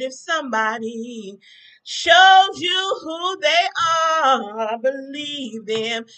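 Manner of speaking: very slow preacher cadence with a rising swell on shows; preaching it slowly. Acted. To her viewers about Trump, slow preaching. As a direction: stretch every word like a sermon closer. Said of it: "I'm singing my song".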